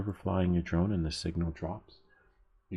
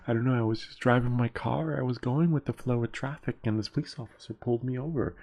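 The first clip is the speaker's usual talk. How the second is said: higher-pitched, put-on protest of innocence; mock innocent excuse; 6 semitones higher than usual. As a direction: plead innocence as a hammed-up bit. Acting the repeat offender's excuse.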